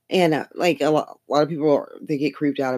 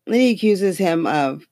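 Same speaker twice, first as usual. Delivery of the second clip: ordinary talk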